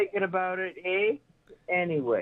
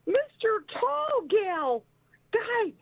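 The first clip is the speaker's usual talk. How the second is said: high, sweet put-on voice asking for help; high, sweet pleading request; 10 semitones higher than usual. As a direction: sweeten it up to get a favour. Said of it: "And I'm like".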